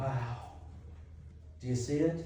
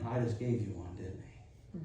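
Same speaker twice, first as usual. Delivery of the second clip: quiet retort; 9 semitones lower, 9 dB quieter than usual. Acted. God answering Gideon.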